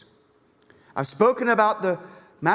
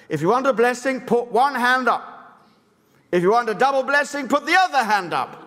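strict revival preacher, pitched higher; Smith Wigglesworth; 6 semitones higher, 3 dB louder than usual. Retold or imitated. Preacher replaying Wigglesworth's orders to the congregation, imitated strict command. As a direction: imitate a commanding meeting opener, high and insistent. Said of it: "he was very strict".

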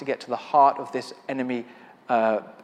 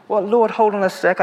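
startled protest; 6 semitones higher, 7 dB louder than usual. Retold or imitated. Protesting to God.

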